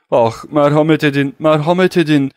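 loud, desperate pleading cry; crying for mercy. Acted. Kedrus pinned under the count's foot, begging loudly for mercy. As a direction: beg for mercy at the top of the voice. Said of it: "diye bağırdı Kedrus".

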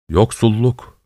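lightly voiced bearded old man; agree, drawing the word out a little; confirming old man. A narrator's reading. Bearded old man backing up the woman, confirming. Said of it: "diye onu doğruladı".

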